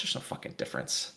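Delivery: low, soft, hushed aside; quiet aside. Real, not acted. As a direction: drop it under your breath. Deadpan grumble at indistinct letters.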